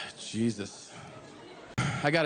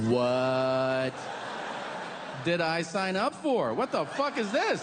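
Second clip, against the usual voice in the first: bewildered outrage; 3 semitones higher, slower than usual. Retold or imitated. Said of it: "And I go".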